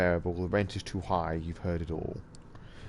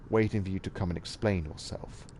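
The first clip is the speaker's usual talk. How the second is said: ordinary talk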